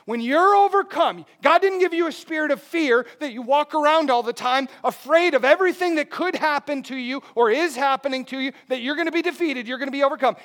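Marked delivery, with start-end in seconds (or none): none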